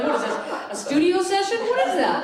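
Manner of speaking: high, loud mock disbelief, laughing; laughing incredulous retort. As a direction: scoff at it in disbelief. Her own incredulous reply.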